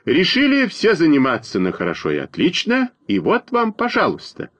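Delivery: high-pitched complaint from the crowd; lightly acted exasperation, voice climbing; mild indignant outcry. A narrator's reading. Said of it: "но отдельные выкрики".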